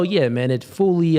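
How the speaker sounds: ordinary delivery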